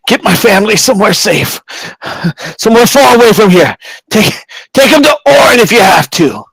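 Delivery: high, strained urgent plea; agonised pleading. Acted. Magistrate begging the captain to save his family, agonised. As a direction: beg through the pain.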